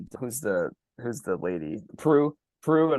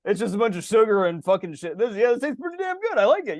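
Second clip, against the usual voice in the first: higher, loud mock drunk delight; hammed-up tipsy foodie, voice pitched up; tipsy judge; 7 semitones higher, 3 dB louder than usual. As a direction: play the drunk judge savouring sugar. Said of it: "I'm just like".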